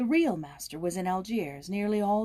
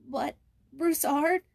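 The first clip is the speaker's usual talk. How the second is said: put-on high, slow, pleading child's voice; a frightened boy begging his master; shrill anxious boy; 8 semitones higher, 5 dB louder, slower than usual. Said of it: "quavered the boy in his shrill, anxious voice".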